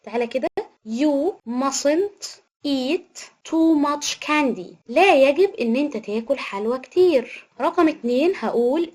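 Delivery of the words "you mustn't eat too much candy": very slow and even; slow dictation. Shown as a demonstration. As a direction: read it out word by word for the class. Teacher dictating example sentence.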